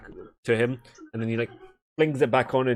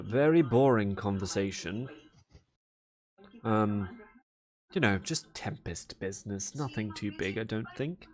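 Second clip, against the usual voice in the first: softer, dismissive brush-off; dismissive Valera; 2 semitones lower, 6 dB quieter than usual. Acted. Valera brushes off the meeting, soft dismissive. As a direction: play it down and fade away. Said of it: "you just see Valera go".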